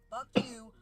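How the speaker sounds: a sudden loud yell of "Fuck you"; cough, then a shouted curse. Retold or imitated. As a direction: spit it out as a shouted parting shot. Final curse before blocking.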